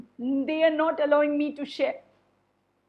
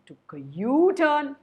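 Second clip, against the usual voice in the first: ordinary delivery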